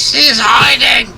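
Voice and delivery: high, slow, teasing sing-song; high teasing shout. Pretend. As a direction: tease her in a high voice.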